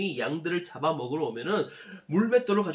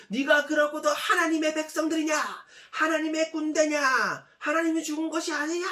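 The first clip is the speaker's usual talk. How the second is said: taunting shout; 10 semitones higher than usual. Acted. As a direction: hurl the insults across the valley. Goliath insulting God's people.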